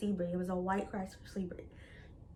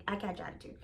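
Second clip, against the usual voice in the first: usual speaking voice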